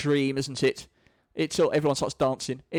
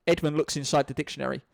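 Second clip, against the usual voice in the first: ordinary delivery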